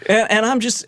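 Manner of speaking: normal talking voice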